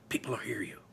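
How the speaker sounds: unvoiced, urgent whisper; whispered warning. A narrator's reading. Aaron warning the narrator not to be heard, whispered. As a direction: warn him under your breath. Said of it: "Aaron hisses".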